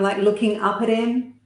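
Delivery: ordinary talk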